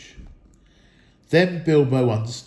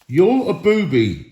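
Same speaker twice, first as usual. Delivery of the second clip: loud, higher insult; insulting troll; 6 semitones higher, 6 dB louder than usual. A narrator's reading. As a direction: sneer the insult. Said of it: "said William".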